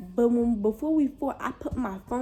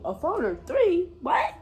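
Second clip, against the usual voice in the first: high, rising disbelief; incredulous; 7 semitones higher, 4 dB louder than usual. Retold or imitated. Counting phones recording the fight, high-pitched incredulity. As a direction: jump way up in pitch, astonished. Re-enacts spotting more phones.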